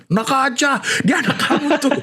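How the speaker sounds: high, laughing imitation of dad; imitating father. Son mimicking father answering mother, high laughing voice. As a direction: squeak out dad's reply through laughter. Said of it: "papa saya bilang gini".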